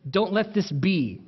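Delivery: ordinary delivery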